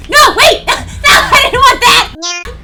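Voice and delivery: loud alarmed protest; shouting. Real, not acted. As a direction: cry out no. Player protesting choice.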